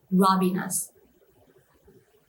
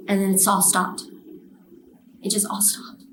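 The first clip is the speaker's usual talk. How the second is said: ordinary talk, nothing marked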